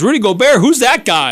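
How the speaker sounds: high, loud dismissive disbelief; mock amazement. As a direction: imitate an awed viewer scoffing at a star. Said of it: "you're like".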